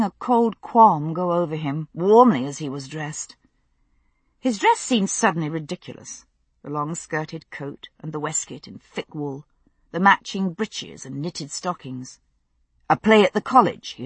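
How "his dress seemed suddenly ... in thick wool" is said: ordinary delivery, nothing marked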